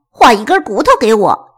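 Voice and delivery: loud demanding dog voice; loud, doggy order; barking dog. Acted. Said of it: "小布汪汪地叫".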